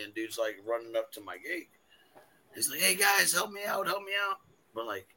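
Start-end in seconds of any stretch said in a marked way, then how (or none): 2.8-4.4 mock screamed plea. Retelling a man at the gate begging the group, mildly frantic. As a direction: imitate a frantic plea with restrained volume. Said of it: "we hear screaming from out here".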